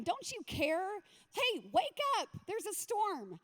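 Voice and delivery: high-pitched alarmed call, no real shout; mock yelling. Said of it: "they start yelling at him".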